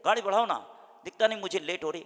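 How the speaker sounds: loud, high, fast scolding; angry passenger. Retold or imitated. A late passenger yelling at his driver, loud and fast. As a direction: hurry the driver up, exasperated. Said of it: "driver को कहता".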